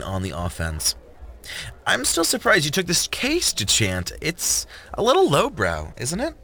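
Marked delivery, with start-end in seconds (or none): 1.9-6.3 higher, mocking prosecutor tone; sharp female lawyer voice. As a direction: needle him with a high voice. Jordan's voice, needling Duchamp.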